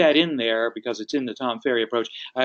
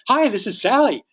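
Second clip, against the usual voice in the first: high, excited phone greeting; upbeat, right up there; 9 semitones higher, 4 dB louder than usual. Acted. Client answering the phone, excited and high. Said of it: "you better be right up there".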